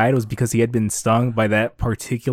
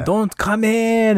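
retold yelling; 10 semitones higher, much slower than usual. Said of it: "'cause it was Leorio yelling".

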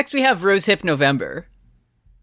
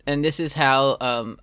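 ordinary talk, nothing marked

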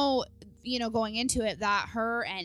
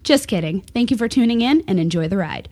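announcer read; 7 dB louder than usual. Acted. Reads show disclaimer intro.

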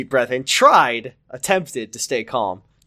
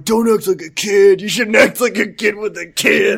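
scolding Ricky; 5 semitones higher, 4 dB louder than usual. Acted. Acted Ricky scolding Amy.

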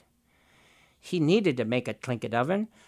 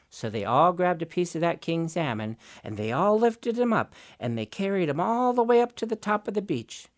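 ordinary delivery, nothing marked

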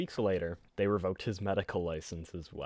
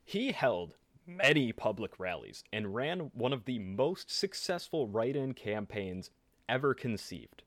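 ordinary talk

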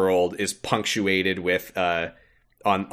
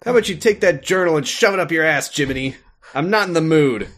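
higher, louder hammed-up rant; mock-furious teen, louder and higher; angry Sora; 7 semitones higher, 5 dB louder than usual. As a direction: tell him off in mock fury. Acted Sora quarrel.

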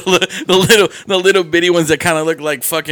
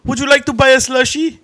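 higher-pitched imitation of a bad Indian accent; mock Indian accent; 6 semitones higher than usual. Mocking a friend's attempt at Mart, in an Indian accent. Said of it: "It was Indian".

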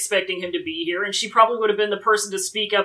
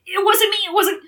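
high, hammed-up tearful protest; whimpering denial; 9 semitones higher than usual. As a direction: play a panicked kid denying it. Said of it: "he's like".

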